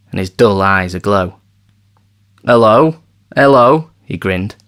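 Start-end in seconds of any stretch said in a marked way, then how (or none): 2.4-3.9 loud, rising hearty hello; eager greeting. A narrator's reading. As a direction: call out cheerily. Ven greets Maynard eagerly.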